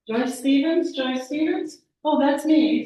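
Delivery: loud, high surprised questioning; startled recognition. Acted. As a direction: exclaim in rising astonishment. Finds her own name on card.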